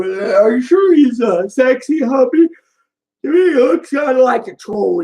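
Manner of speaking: teasing a cousin about her fireman crush; teasing mock question. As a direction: tease her about the hubby. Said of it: "say something like".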